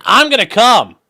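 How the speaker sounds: jokey moaning read of a super chat; mock moan. Shown as a demonstration. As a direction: overdo it for a laugh. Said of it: "Well, hold on".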